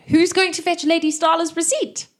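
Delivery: high, loud questioning call; boatwoman's voice. Acted. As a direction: call up in a put-on woman's pitch. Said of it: "She's, uh".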